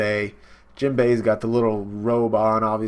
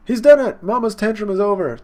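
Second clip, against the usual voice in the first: much higher, much louder shout of relief; excited cheer; 9 semitones higher, 8 dB louder than usual. Retold or imitated. Townsfolk celebrating Jinbei's rescue, a loud high cheer. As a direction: cheer like the danger just ended.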